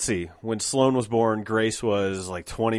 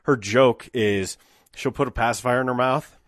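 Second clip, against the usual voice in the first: ordinary talk, nothing marked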